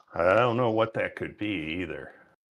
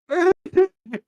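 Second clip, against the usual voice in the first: very high, loud mock whimper; exaggerated high blubbering to tease someone; mocking crying imitation; 5 dB louder than usual. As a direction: mimic a crying baby in a squeaky pitch. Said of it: "Does he sound like this?".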